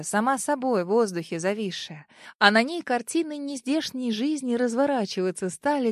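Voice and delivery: ordinary delivery, nothing marked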